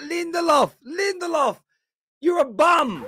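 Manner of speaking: high, loud jeering shout at the defender; mock fan shout. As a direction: bark the name like a furious fan in the stands. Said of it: "He's like".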